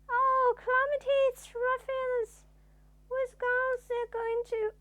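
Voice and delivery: hammed-up hysteria; panicked. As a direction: squeal in terror. Mister Jelly panicking at leaf.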